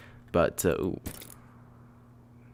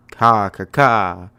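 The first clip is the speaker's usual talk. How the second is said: loud imitated caws; bird cawing; 5 dB louder, much slower than usual. Saying goodbye to pet bird, loud cawing. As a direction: caw out like a crow.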